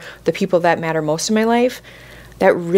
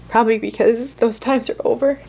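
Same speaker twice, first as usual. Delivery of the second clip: higher, tearful and wistful; getting emotional; 4 semitones higher than usual. Real, not acted. Mother mourning her kids' younger years, emotional. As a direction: hold back real tears. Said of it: "why I'm getting emotional".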